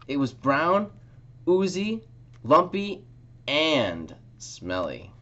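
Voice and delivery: lively picture-book story time voice; reading to children. A narrator's reading. Librarian reading a picture book to children, lively. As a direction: read it aloud for little ones at story time.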